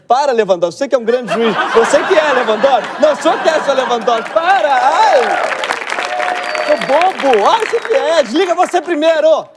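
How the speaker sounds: high, giggly lovestruck flirting; giggly late night call.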